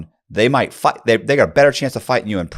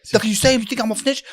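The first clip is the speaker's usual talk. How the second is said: higher, indignant retold outburst; imitating an angry inmate; 13 semitones higher, faster than usual. Inmate accusing the new guy of calling him a snitch, indignant. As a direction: throw the accusation back hot.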